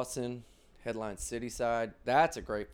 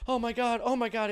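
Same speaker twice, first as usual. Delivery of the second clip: much higher, louder gasping concern; mock alarm; 11 semitones higher, 6 dB louder than usual. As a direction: strangers fussing over a fall. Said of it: "people would swarm over and be like".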